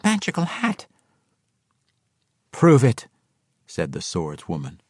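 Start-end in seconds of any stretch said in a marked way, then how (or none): 0.0-0.8 small, high-pitched voice; squeaky explanation. A narrator's reading. Halfling points at his beret for the swordswoman, squeaky. 2.5-3.1 loud, curt demand; firm challenge. A narrator's reading. Swordswoman challenges the halfling at sword point, demanding.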